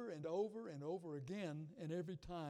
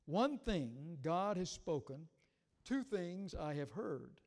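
loud, declaiming the psalm; preaching voice, full conviction; scripture read with force; 5 dB louder, slower than usual. Acted. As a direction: proclaim it to the congregation. Slow, building psalm reading.

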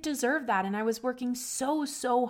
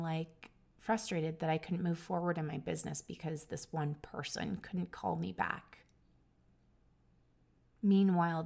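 low, hushed, with long heavy pauses; real subdued shock, voice dropping away; sombre; 3 semitones lower, 3 dB quieter than usual. Real, not acted. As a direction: let the weight of it sink in, softly. Recalls learning the woman had died.

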